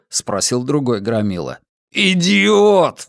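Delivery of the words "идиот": loud angry outburst; exploding. Acted. Hermund blowing up at the thug, angry outburst. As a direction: blow up at the fool. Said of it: "взорвался Гермонт".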